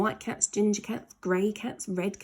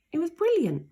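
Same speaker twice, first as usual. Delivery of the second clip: excited; 10 semitones higher, 3 dB louder, faster than usual. A narrator's reading. Solution found.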